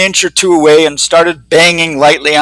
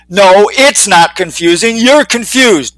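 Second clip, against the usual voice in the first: slow, spelled-out put-down; condescending Bixby; 4 semitones higher, slower than usual. Acted. Bixby slowly corrects Eugene, condescending. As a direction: lay it out slowly as if to a child. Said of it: "Bixby said".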